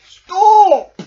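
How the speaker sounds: high, very loud call. Acted. Badger stops the friends' rumpus, very loud. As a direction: halt the squabble at once. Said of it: "called Badger".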